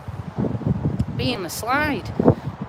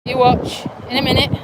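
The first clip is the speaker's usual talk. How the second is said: ordinary talk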